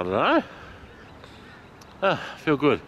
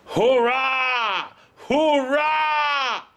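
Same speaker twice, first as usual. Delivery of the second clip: joyful shout; 12 semitones higher, much slower than usual. Real, not acted. Walker shouting victory to viewers, joyful shout. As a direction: yell out a triumphant double cheer. Celebrating walk time.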